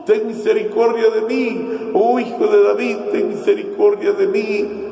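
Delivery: high-pitched pleading call, long first word; imitated crying out. Blind man begging Jesus for mercy, high imitated cry. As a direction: call out as the desperate beggar, without real shouting. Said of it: "empieza a buscar y a gritar".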